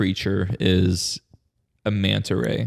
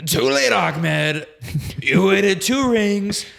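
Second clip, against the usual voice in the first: Donald Trump; 10 semitones higher, 3 dB louder than usual. Acted. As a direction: stretch each threat out, pitched well up. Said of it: "that was a really good Trump impression".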